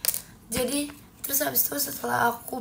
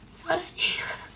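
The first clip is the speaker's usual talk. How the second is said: higher, breaking off into a whisper; voice gone; 6 semitones higher than usual. Real, not acted. Streamer showing viewers the voice cut out, voiceless. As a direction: let the voice vanish into air.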